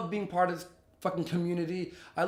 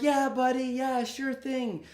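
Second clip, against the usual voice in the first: higher, slow humoring tone; placating drunk; 6 semitones higher than usual. Retold or imitated. Humoring a volatile person so she won't snap, high and slow. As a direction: soothe someone about to throw a punch. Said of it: "So you're like".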